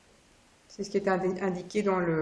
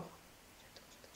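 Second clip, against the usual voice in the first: breathy whisper of delight; 32 dB quieter, slower than usual. Real, not acted. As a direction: murmur it to yourself, voiceless. Admiring cards, whispered.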